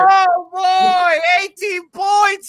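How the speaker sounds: high, loud hammed-up shout of alarm; mock alarm. Hyping the crowd over the lead, loud mock warning. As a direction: yell a jokey warning to everyone.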